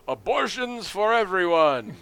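high, loud, drawn-out stump proclamation; Bob Dole alien candidate. Retold or imitated. Alien candidate addressing a rally, hammed-up loud proclamation. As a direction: declaim it slowly to a crowd. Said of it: "pretending to be Bob Dole gets up there".

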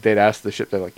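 ordinary talk, nothing marked

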